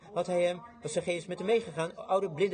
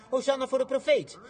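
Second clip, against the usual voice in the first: high, projected acclaim; excited shout of praise, not full-out; crowd's acted cheer; 7 semitones higher, 4 dB louder than usual. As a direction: hail the prophet as you run. Crowd runs, cheering the prophet.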